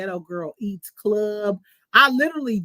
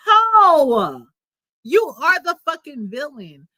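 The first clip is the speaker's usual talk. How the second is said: outraged shout; 3 semitones higher, 7 dB louder, slower than usual. Real, not acted. Vlogger outraged at [name] crying, shouting Ho. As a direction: blurt it out in disgust.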